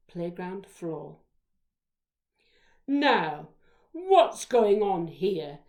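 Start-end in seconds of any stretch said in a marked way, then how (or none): 2.9-5.6 loud, slow teacher's bellow; big, slow, commanding bellow. A narrator's reading. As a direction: boom it out like a stern teacher. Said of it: "in her big bellow voice".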